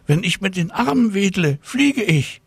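put-on very high, exclaimed child voice; excited calling out. A narrator's reading. Tina announcing she can fly, high excited cry. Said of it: "rief Tina Luise".

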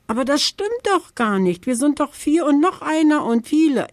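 high, protesting little bear; objecting. Acted. Eddie Bär objects to the raven's plan, high and protesting. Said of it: "widerspricht Eddie Bär".

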